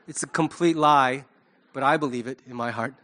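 ordinary delivery

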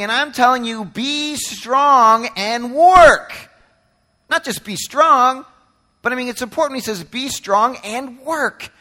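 full-force preaching. Acted. Pastor urges the church to persevere, full-force preaching. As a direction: press the point home to the congregation.

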